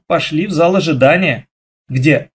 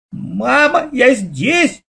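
put-on high child's call, rising on «я»; a boy shouting from inside; 9 semitones higher, much slower than usual. A narrator's reading. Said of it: "вдруг раздался Лёшкин голос". (мальчик звонко кричит маме)

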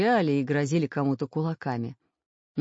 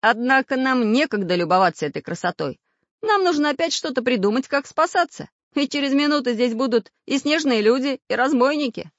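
raised, louder, pressing voice; urgent warning; 9 semitones higher, 6 dB louder than usual. A narrator's reading. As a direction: press them to think of an escape. Samodelkin warns of approaching danger.

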